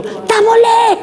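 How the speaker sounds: high, squeaky mock refusal; curt refusal. Toll driver refusing the stranger's card, squeaky high. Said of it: "Orang tu".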